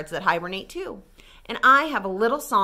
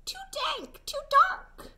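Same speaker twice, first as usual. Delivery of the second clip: tiny high mouse voice, fussy; squeaky mouse; 6 dB quieter than usual. A narrator's reading. Reading to kids, the mouse complains in a high squeak. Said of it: "Mouse squeaks".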